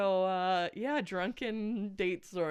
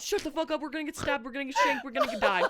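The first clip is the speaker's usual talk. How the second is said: panicked hush-yell; 7 semitones higher, faster than usual. Retold or imitated. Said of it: "And I'm like".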